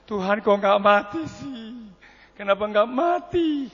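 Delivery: slow, drawn-out complaint to heaven; mild mock lament. Wife asking God why she survived, mildly lamenting. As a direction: stretch it into a plaintive question.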